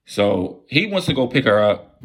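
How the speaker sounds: normal talking voice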